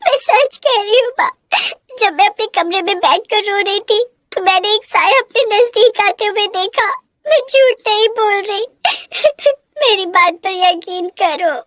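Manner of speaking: put-on a scared child begging her mother; pleading girl near tears. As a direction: beg to be believed, breaking.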